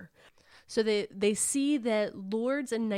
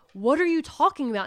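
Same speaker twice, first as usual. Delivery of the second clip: higher, louder mock challenge; retold quarrel in a raised voice; argumentative; 6 semitones higher, 5 dB louder, faster than usual. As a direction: act out arguing back, incredulous.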